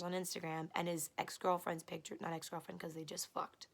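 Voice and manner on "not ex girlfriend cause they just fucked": unvoiced dismissive aside; whispered aside. Real, not acted. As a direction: hiss it out of earshot.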